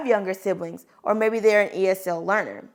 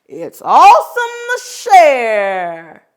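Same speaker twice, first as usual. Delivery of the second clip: slow, louder voiced line; Joe's voice; 9 dB louder, slower than usual. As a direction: say it slow and proud as Joe.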